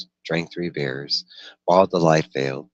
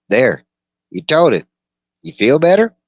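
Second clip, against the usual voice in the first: high, loud raised voice for the dweller; Brown's voice; 11 semitones higher, 9 dB louder than usual. Acted. Dweller Brown questioning the gunslinger by the fire, high and loud. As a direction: pitch him well up and push it out.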